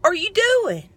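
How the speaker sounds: high alarmed cry of surprise, retold; startled high-pitched protest; startled yelling. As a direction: shriek it like she just got pinched on a ladder. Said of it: "I screamed and I turned around and I said".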